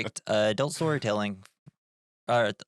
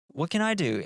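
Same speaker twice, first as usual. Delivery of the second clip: lightly higher mimic of Kylie's voice; Kylie's quote; 7 semitones higher than usual. Imitating Kylie asking the same question, light higher voice. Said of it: "Kylie's like".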